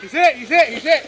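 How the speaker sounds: man down shout. Real, not acted. Man down callout in game.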